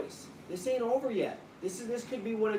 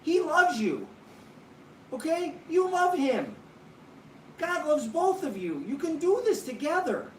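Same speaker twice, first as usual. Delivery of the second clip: tearful pleading; 7 semitones higher than usual. Real, not acted. Pastor's wife on the phone begging Trish to return, crying. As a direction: plead through tears, pitch up and pushing. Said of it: "Don't cry".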